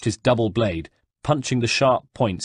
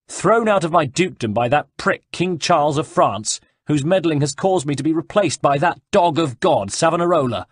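resentful, raised and higher; angry exile; 6 semitones higher, 4 dB louder than usual. Acted. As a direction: curse the king and the monk. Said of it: "now contorted in anger".

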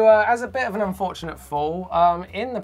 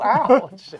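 pained wince; 5 semitones higher, 6 dB louder than usual. Real, not acted. Wincing at crash video.

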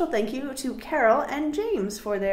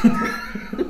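laughing; 5 dB louder than usual. Real, not acted.

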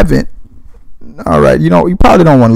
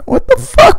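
put-on high shrieking baby. Vlogger's newborn voice rising into a high squeal. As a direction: jump way up into a squealing newborn cry. Second take of the baby line.

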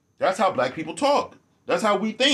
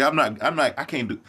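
ordinary delivery

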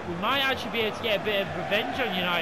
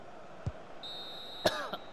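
wheezy coughing fit; choking cough; 7 dB quieter than usual. Real, not acted. Gamer choked by celebrating, wheezy coughing. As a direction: cough and wheeze after screaming too hard. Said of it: "I actually choked when I was celebrating".

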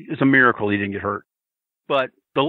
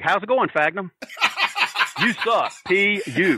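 higher, mocking jeer broken by laughter; laughing taunt; 6 semitones higher than usual. Fan holding the sign over Magnum, jeering through laughter. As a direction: rub it in while cracking up.

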